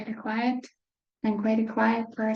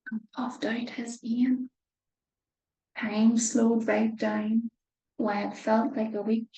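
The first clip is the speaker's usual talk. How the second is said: ordinary delivery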